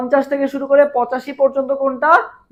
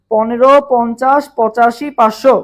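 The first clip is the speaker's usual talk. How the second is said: slow, louder chant, one number at a time; slow number drill; 5 dB louder, slower than usual. Shown as a demonstration. Teacher leading students through the layer heights, slow louder chant. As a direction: spell out each number for the class to repeat. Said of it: "সবাই একটু দ্রুত comment করে ফেলো তো".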